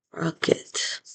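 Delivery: "Rocket" whispered to herself while writing. Shown as a demonstration. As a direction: breathe the word under her breath. Spelling aloud to herself.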